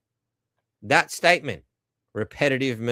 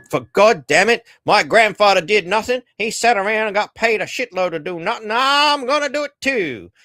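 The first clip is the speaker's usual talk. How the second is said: loud, high-pitched indignant outburst; mock indignant rant; 8 semitones higher, 7 dB louder than usual. Entitled master demanding grandfather's idle life, mock indignant. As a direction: rant louder and higher, building to a high peak.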